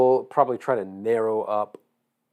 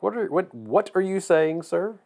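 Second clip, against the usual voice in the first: high, slow mock Missouri accent; drawn-out Missouri accent, puzzled; 9 semitones higher than usual. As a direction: imitate a baffled Missouri local, pitched up. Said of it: "people couldn't understand me, especially in Missouri".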